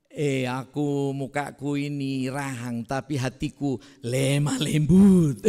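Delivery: drawn-out Batak delivery, swelling loud on "lemah lembut"; rough Batak speaker. Acted. Preacher mimicking how Batak people claim to be gentle, rough Batak voice. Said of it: "bilang lemah lembutnya pun kasar sekali".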